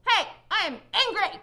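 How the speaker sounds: loud, high mock yell with a laugh; hammed-up angry shout for children; demo yell. Shown as a demonstration. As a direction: yell it to show a bad way, half laughing. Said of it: "What if I yell back at the people".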